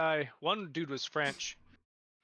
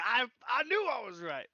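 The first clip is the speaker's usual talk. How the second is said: high, stammering triumphant quote; excited vindication; 8 semitones higher than usual. Retold or imitated. Imitating Jay after the spy reveal, excited vindication. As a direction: act out excitedly being proved right.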